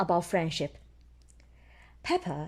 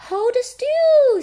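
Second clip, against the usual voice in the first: put-on high, loud little girl voice; high child voice telling a friend to stop moving; Susie's voice; 10 dB louder than usual. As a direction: squeak it like a bossy little sheep. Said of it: "Susie says".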